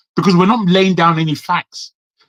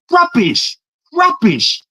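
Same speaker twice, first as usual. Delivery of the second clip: scornful outburst; 11 semitones higher, slower than usual. Real, not acted. Exasperated outburst at debaters.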